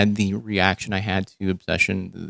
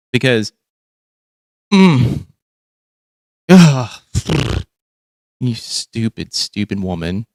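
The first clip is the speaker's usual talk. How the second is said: 1 mock exasperation; slower than usual. Real, not acted. Mocking mom's bad accent.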